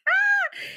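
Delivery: short, high real laugh. Real, not acted. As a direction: let out a quick high laugh. Laughs at her children interrupting.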